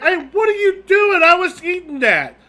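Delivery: high loud outraged customer; shrill-free high indignant protest; indignant diner. Acted. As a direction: squawk it like a put-out diner. Diner complains to fake inspectors.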